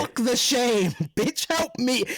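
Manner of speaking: high, exasperated cry for help; comic desperate outburst; urgent mock plea. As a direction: blurt it high, brushing the shame aside. Acting out plea she should have made.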